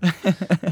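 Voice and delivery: burst of laughter. Real, not acted. Laughs at own remark.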